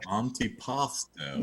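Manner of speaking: slow, deliberate Karloff drawl on Antipasto; Boris Karloff impression. Shown as a demonstration. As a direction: stretch out Antipasto like a horror villain. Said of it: "See? That's it".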